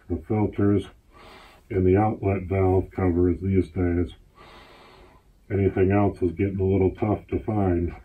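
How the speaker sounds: muffled behind mask; speaking inside respirator; through a gas mask. Real, not acted. Seen 2: Full-face gas mask worn throughout; reference frames show bare face.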